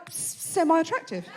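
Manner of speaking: lightly acted reluctant partner, hushed; hesitant verdict. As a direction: offer it gingerly, quietly. Partner hesitantly judging her looks.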